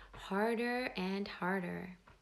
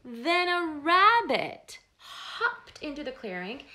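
dramatic announcement; 6 semitones higher, 11 dB louder than usual. Acted. Dramatic narration.

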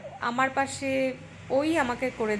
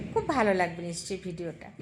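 normal talking voice

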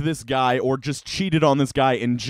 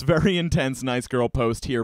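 usual speaking voice

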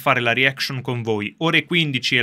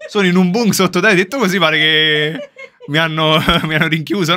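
laughing; 3 semitones higher, 4 dB louder than usual. Real, not acted. Joking about being locked underground.

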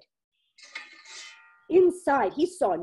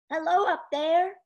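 higher, brighter-pitched neighbor call; friendly neighbor; 6 semitones higher, 4 dB louder than usual. Acted. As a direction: call up cheerfully to the balcony. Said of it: "Mister Henderlin said".

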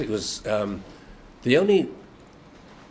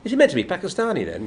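mocking; 8 semitones higher, 3 dB louder than usual. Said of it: "take the mickey out".

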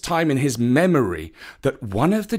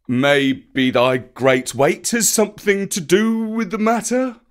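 teasing bear; 7 semitones higher than usual. A narrator's reading.

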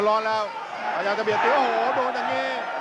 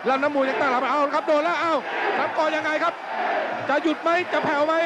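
higher, urgent ringside call; excited boxing commentary; 5 semitones higher than usual. Acted. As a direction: build the tension as the fighter tires. Live boxing play-by-play.

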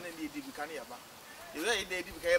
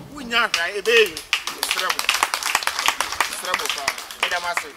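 loud pleading; 3 semitones lower, 10 dB louder than usual. Real, not acted. Pleading with rally crowd loudly.

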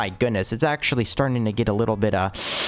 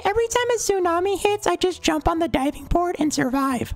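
much higher falsetto lady voice. Acted. NPC lady explaining her diving-board trick in a high falsetto. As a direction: squeak it out like a smug lady.

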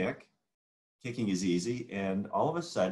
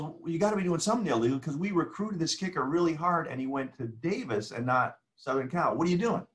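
mildly accusing, pitched up; retold berating; 6 semitones higher than usual. Rival coach accuses Davis over a lost kicker, retold berating. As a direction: imitate a rival coach scolding. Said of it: "I just got berated on the phone".

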